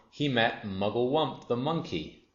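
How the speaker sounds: usual speaking voice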